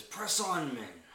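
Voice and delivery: loud, high rallying shout to soldiers; commanding yell. Acted. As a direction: play an officer urging troops onward. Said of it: "He yells at the, uh, other soldiers".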